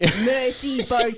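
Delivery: slow hammed-up French accent. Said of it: "She doesn't sound like that".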